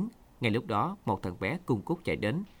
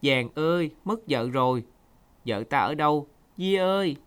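loud, high wail of loss; a husband calling for his missing wife in anguish; anguished cry; 5 semitones higher, 4 dB louder than usual. A narrator's reading. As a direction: cry out in pain for the lost wife. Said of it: "Tè kêu lên đau đớn".

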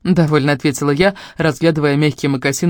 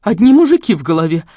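louder, higher irritated remark; nervy jibe; 4 semitones higher, 6 dB louder than usual. A narrator's reading. Mother snipes at daughter, turning away, nervy. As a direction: toss it out in irritation. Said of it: "нервно бросила мать".

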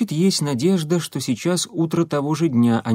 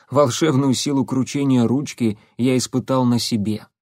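ordinary delivery

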